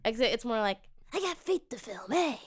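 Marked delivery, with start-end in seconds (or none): 1.1-2.4 soft, creepy Tarantino voice; creepy Tarantino impression. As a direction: play Tarantino quietly and creepy. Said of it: "Like that".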